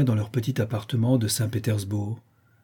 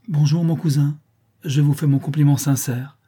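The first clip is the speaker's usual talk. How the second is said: gracious; 4 semitones higher, 4 dB louder than usual. A narrator's reading. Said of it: "avec cette grâce parfaite".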